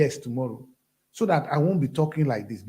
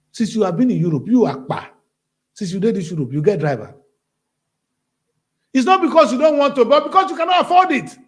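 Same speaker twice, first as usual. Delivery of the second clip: raised, rising to a near shout; retold heated answer; 8 dB louder than usual. Retelling his reply to the ministry, loud and heated. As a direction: lecture the ministry loudly, building up. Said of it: "and I answered".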